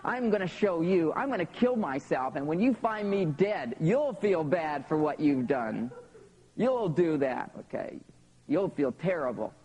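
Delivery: put-on a child vowing to make dad sorry; righteous child's fury. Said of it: "I was furious".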